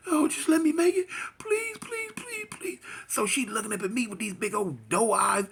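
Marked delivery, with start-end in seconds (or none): 0.1-2.8 very high sobbing pleas; crying prayer. Retold or imitated. Girlfriend praying on the floor, sobbing pleas. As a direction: plead through sobs. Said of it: "she praying".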